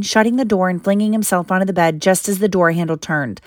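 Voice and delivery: ordinary delivery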